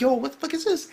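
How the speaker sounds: high, baffled exclamation; shocked reaction. Retold or imitated. Reacting to clothes getting blown off, shocked. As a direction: retell it in high-pitched disbelief.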